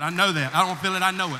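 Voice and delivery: loud, firm conviction; emphatic declaration. Real, not acted. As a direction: proclaim it with certainty. Preacher insisting with conviction.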